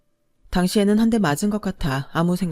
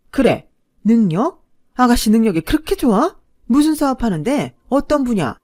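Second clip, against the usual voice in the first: louder, higher scornful questioning; sarcastic, challenging mother; 6 semitones higher, 8 dB louder than usual. A narrator's reading. Mother grilling her son's girlfriend about her business, scornful challenge.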